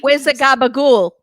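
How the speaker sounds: put-on loud Italian-American caricature accent; Joey. Co-host mocks her voice, hammy Italian-American accent. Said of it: "She sounds like Joey".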